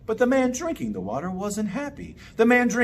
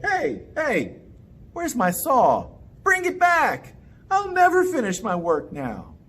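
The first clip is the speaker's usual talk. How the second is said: angry man; 7 semitones higher than usual. Acted. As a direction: demand the saw back, upset. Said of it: "The man was angry".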